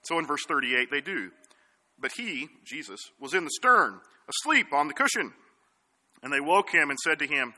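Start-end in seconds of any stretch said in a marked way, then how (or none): none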